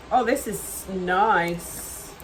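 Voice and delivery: ordinary talk, nothing marked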